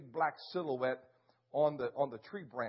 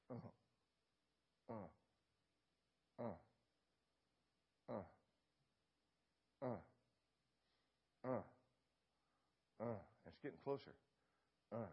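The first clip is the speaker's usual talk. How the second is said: soft imitated lion grunting; low breathy grunts of a lion in the dark; 7 semitones lower, 16 dB quieter, faster than usual. Hunter imitating a stalking lion, soft grunts. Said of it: "I hear this sound".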